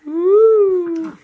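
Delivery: loud, high whoop; excited woo. Real, not acted. Dad whooping to get a reaction from his baby.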